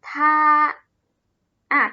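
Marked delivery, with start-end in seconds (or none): 0.1-0.3 ทา held long on an even tone; model pronunciation. Shown as a demonstration. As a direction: sound out ทา clearly for learners to copy. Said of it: "ออกเสียงว่า".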